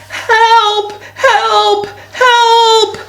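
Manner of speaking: very high storybook cry for help; acted frightened shouts. A narrator's reading. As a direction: call out in alarm, high and held. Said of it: "in a very frightened voice".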